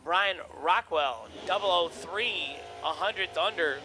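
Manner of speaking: loud PA call of the pairing; race announcer. Acted. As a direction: announce the lanes boldly. Live drag race calling.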